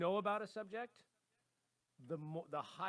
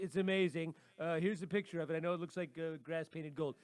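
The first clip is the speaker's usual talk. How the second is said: toupee-wearing salesman. Acted. Co-host playing a toupee-wearing dealmaker selling land, grandiose salesman. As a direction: pitch it big, stretching out every boast. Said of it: "put a toupee on my head, and I'll be like".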